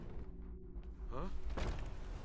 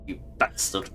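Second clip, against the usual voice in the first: whispered curse; 5 semitones lower, 12 dB quieter than usual. Real, not acted. Cursing at locked crate.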